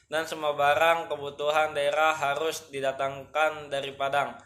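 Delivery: ordinary talk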